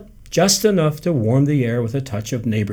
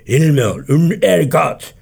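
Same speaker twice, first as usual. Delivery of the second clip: French mumble; 4 semitones higher, 6 dB louder, slower than usual. A narrator's reading. Grandfather mutters French phrase.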